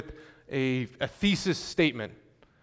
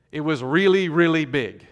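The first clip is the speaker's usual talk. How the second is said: really, really said louder for emphasis; louder demo of emphasis; emphatic repetition; 2 semitones higher, 8 dB louder than usual. Shown as a demonstration. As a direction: punch both reallys. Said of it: "I'm emphasizing the bigness".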